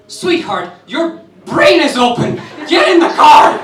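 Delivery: loud and high, laughing, half-pleading; mocking the rescuer's exasperated command; laughing urgency. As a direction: burst out laughing while ordering him. Rescuer urging him into car.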